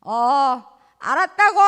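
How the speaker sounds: loud, offhand yeah-yeah; careless brush-off. Acted. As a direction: answer without caring. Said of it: "성의 없이 이거를 한쪽 귀로". (성의 없이 대충 대답하는 말투)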